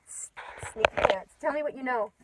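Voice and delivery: breathy playful whisper; teasing whisper. Real, not acted. Teasing Joy as sneaky, in a playful whisper. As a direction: whisper it like a shared secret.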